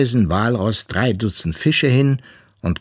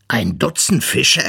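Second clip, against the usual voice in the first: plain reading voice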